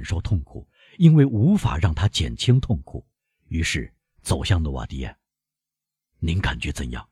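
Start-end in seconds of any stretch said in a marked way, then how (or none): 6.2-7.1 hurried low question. A narrator's reading. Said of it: "他匆促地低声问".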